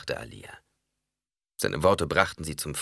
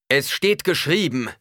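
high, loud, slow proclamation; thundering; 9 semitones higher, 7 dB louder than usual. A narrator's reading. Preacher quotes what is written, thundering. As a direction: thunder it like scripture over a crowd. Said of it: "donnerte er".

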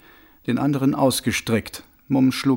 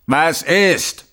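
curt, loud snap; sharp; 5 semitones higher, 6 dB louder than usual. A narrator's reading. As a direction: cut him off sharply. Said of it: "fragte er scharf".

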